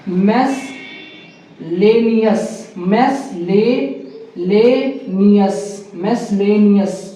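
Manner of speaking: मेसलेनियस very slow, mes... lay... nias; syllable-by-syllable pronunciation. Shown as a demonstration. Teacher drilling a hard word, slowly broken up. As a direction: break मेसलेनियस apart with pauses. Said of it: "इसको बोलेंगे".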